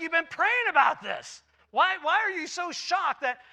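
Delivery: why are you surprised, you asked for it; exasperated. Pretend. Exasperated reply to the shocked priest.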